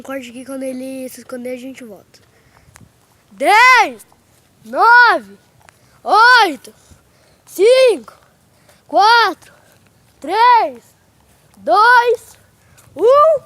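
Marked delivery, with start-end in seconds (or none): 3.3-13.4 calling out the count, loud; loud countdown. Real, not acted. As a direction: shout each number across the park. Counting loudly for hide and seek.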